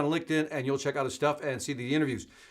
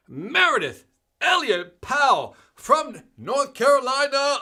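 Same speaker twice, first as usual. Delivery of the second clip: slow, louder, higher name call; drawn-out shoutout; 8 semitones higher, 4 dB louder, slower than usual. Real, not acted. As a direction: stretch the name out like an announcer.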